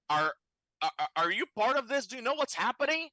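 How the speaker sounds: high, stammered call through the door; high mock yell. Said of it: "and I yell".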